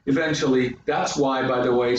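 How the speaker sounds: ordinary delivery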